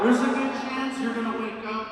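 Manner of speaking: ordinary talk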